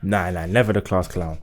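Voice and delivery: ordinary delivery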